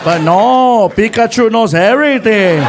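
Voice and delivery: loud, drawn-out told-you-so; sarcastic scolding. Retold or imitated. Friend to driver after warning, loud scolding. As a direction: imitate a buddy rubbing it in.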